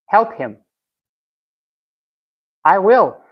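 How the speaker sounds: ordinary delivery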